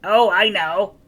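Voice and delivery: the keen pupil who knows; chirpy. Acted. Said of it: "chirped the red monster".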